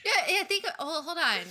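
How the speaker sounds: cracking up while trying to talk; laughing. Real, not acted. Cohost laughing while trying to regain focus, high and loud.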